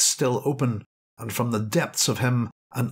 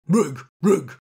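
dog's cry; 5 semitones higher, 4 dB louder than usual. Acted. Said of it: "he cried".

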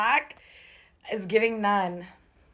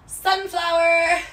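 high, drawn-out cry of delight; light up at the sunflower socks; a spoken Sunflower with a rise of joy; excited; 8 semitones higher, slower than usual. Real, not acted.

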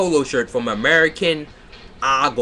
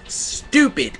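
frustrated; 10 semitones higher than usual. Real, not acted.

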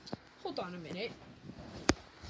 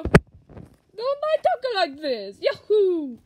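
high, slow, cartoonish plumber voice; Mario voice; 7 semitones higher, 8 dB louder, much slower than usual. Vlogger drops into a high slow Mario voice. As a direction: stretch it out like a cheerful plumber.

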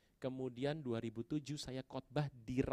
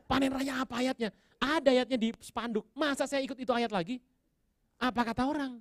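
high, loud re-enacted fretting; frantic protest; 11 semitones higher, 6 dB louder than usual. Preacher with no verse protesting to God, frantic. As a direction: argue desperately, as if the clock is running out.

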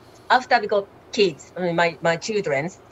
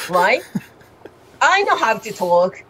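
normal talking voice

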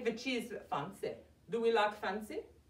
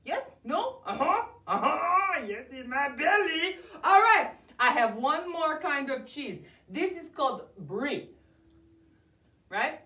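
ordinary talk

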